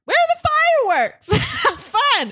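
sudden high, incredulous squeak; mock surprise. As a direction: act baffled, voice jumping up high. Mock outrage at early fireworks.

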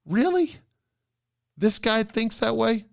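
high, disbelieving squeak; mock disbelief; incredulous. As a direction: act stunned someone could think this. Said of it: "And was like".

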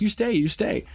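high imitated broken English, insistent; broken-English shopkeeper. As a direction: urge him to stay in her high accented voice. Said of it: "'Cause she can't really speak".

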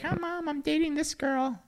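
put-on high, slow kid confessing; shy teen telling mom.

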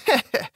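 short high "heh-heh"; acted chuckle. A narrator's reading. Priest amused by the havoc outside, short acted chuckle. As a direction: give a brief high chuckle. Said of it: "засмеялся богослужитель".

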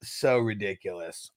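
usual speaking voice

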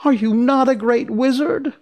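put-on high, loud girlish disbelief; crying out. A narrator's reading. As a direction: demand it in a high, dismayed cry. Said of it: "cried Dorothy".

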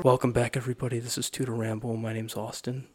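low, toned-down show intro. Shown as a demonstration. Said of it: "weird announcer voices".

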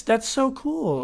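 high, stretched-out delight; thrilled reaction. Real, not acted. Thrilled by the compatibility news.

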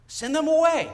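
loud, high, urgent send them away; shouted demand. Retold or imitated. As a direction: yell it like frantic disciples at the boss. Apostles urging Jesus, climax.

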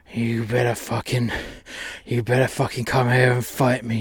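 usual speaking voice

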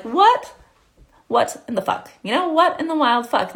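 the universe hammed-up, baffled and exasperated; mock disbelief. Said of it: "the universe is over here being like".

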